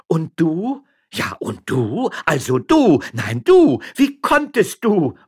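loud, high volley of retorts; quarrelling reproaches. Acted. Two married couples trading blame, loudly reproachful. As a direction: escalate each accusation.

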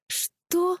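very high shocked one-word question; high-pitched disbelief; stunned. A narrator's reading. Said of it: "только и смогла произнести Женя".

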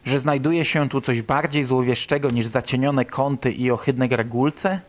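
usual speaking voice

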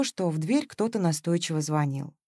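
usual speaking voice

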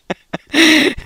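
loud, high burst of laughter. Real, not acted. Radio host chatting on air, loud laughter.

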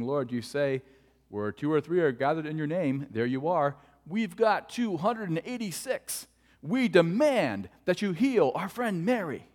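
loud, demanding prayer, rising in pitch; mock aggressive prayer-meeting plea; bossing God around. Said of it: "They're bossing God around".

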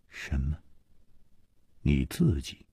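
low, soft echoing question; hushed disbelief. Acted. Chu puzzled by her motive, quietly incredulous. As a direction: query it quietly, taken aback.

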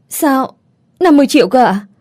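sharp high startled question; panicked disbelief. A narrator's reading. Young mother hearing the fee, panicked. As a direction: gasp out the sum in shock. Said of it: "Tôi hốt hoảng nói".

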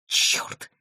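soft, voiceless hiss; hissed, whispery swear word; hissed curse. A narrator's reading. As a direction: curse under the breath mid-stumble. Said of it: "прошипел он в полёте".

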